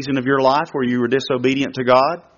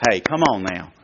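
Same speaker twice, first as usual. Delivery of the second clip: gentle inner voice; 7 semitones lower than usual. Pretend. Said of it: "Just gently saying".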